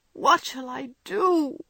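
a daughter's slow, despairing repeat; despairing plea, slowed. Acted. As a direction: stretch out the plea hopelessly. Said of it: "cried poor Jo in despair".

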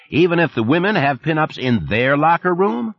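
a chummy Texan supervisor on the phone; Texas twang. Acted. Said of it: "the familiar voice with the Texas twang".

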